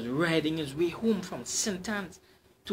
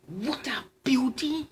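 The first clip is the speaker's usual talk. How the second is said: awed whisper; 9 semitones higher than usual. Acted. Cyclist admires the woman.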